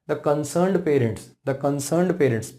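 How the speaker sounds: very slow, each word drawn out; slow dictation. Shown as a demonstration. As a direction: say it slowly so students can write. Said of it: "dictates example phrase slowly". (बहुत धीरे बोलकर लिखवाना)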